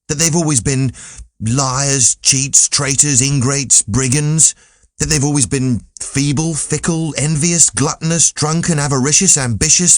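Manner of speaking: earnest youth listing mankind's sins; indignant young Candide. Acted. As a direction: Candide piling up accusations, slowing near the end. Candide's long list of vices.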